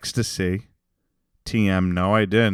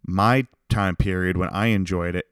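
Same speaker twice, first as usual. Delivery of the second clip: normal talking voice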